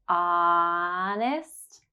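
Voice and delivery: "Honest" with a long held ah. Shown as a demonstration. Teacher stretching the ah in "Honest" for learners. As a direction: draw out the opening ah of "Honest". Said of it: "has the ah sound right at the beginning".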